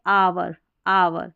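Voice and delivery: आवर said slowly as "aa-var", no h; silent H. Shown as a demonstration. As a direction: stretch आवर from its opening vowel. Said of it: "क्योंकि एच silent है".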